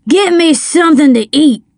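put-on high-pitched drunken demand; bullying order. A narrator's reading. Drunken man ordering Miss Rose to feed him, bullying. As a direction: order her about.

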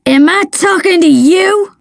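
mild shout. A narrator's reading. Drunken man rounding on the child, shouting. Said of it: "he shouted".

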